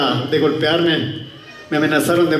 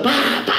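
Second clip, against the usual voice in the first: high "pa, pa" falling noises; spoken impact sound effect; imitated thuds; 8 semitones higher than usual. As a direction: voice things plopping down. Imitates rats dropping from ceiling.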